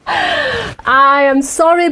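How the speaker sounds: laughing apology, slow; can't stop giggling as you apologise; laughing sorry. Real, not acted. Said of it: "I know I shouldn't laugh".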